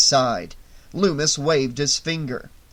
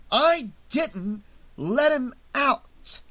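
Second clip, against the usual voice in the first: higher, slow, deliberate denial; a measured, pointed correction; slow insistence; 9 semitones higher, much slower than usual. A narrator's reading. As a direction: spell out each word, holding firm. Loomis insists, slowly.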